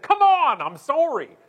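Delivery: high loud yell tumbling into apology; apologetic outburst. Acted. Canadian swearing at flight attendant, loud yell softened by apology. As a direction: yell it, then instantly say sorry. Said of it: "Actually, I think I said".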